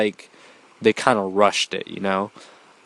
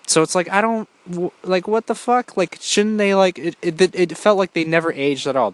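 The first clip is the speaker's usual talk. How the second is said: much higher, incredulous complaint; baffled exasperation; 8 semitones higher than usual. Real, not acted. Reviewer ranting about actors never aging, baffled. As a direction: sound stunned that nobody aged.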